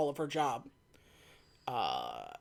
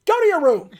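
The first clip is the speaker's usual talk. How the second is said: raised, high scolding shout; parental scolding; 10 semitones higher, 8 dB louder than usual. Acted. M telling Bond off, scolding shout. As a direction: send them off like a cross parent.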